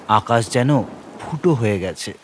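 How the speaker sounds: ordinary delivery, nothing marked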